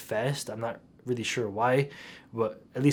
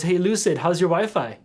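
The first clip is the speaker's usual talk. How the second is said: high, taunting mock UK accent; Syskey's UK accent; 6 semitones higher, 4 dB louder than usual. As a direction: imitate a British troll toying with his victim. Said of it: "he asked me in his U K accent".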